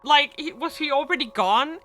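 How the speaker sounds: slow, high, loud where is he; drawn-out disbelieving question; exasperated. Real, not acted. Exasperated at missing character.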